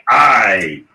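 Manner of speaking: pirate accent. Shown as a demonstration. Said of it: "we say".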